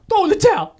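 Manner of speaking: high loud mock yell; imitating the trainer screaming; Tony yell. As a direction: shriek it at the corner. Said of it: "my boy Tony".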